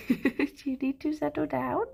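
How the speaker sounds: calm the pet gently; soothing pet talk. Real, not acted. Owner gently settles her pet down, soft.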